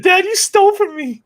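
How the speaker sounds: put-on high, slow whiny kid voice; mock son. Co-host jokingly voices the son accusing dad, high and slow. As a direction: whine it like a wronged son. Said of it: "He said".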